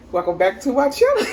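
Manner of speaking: greeting breaking into real laughter; laughing through it. Real, not acted. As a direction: say the welcome while cracking up. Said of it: "Let me stop that".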